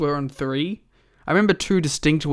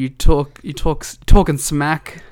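loud accusation; 8 dB louder than usual. Real, not acted. Accusing cohost.

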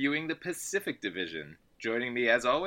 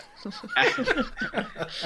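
burst of laughter. Real, not acted. Host laughing at the Warriors blowing a lead, high burst of laughter. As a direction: crack up at the joke.

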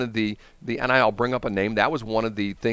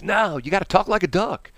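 higher-pitched pushy boss; insistent station boss; 5 semitones higher than usual. Retold or imitated. Boss orders new radio hire, high and insistent. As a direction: lay down an absurd rule, pitched up. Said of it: "the boss".

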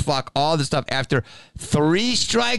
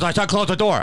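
higher imitated command; mock the man insisting; insistent order; 4 semitones higher, faster than usual. Hotel man repeating his order in a quarrel, insistently. Acted quarrel over the door.

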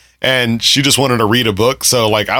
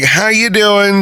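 slow, high flirty "How you doing?"; flamboyant flight attendant; 8 semitones higher, slower than usual. Retold or imitated. Flight attendant leaning on her chair, slow high camp flirt. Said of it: "He does it so well".

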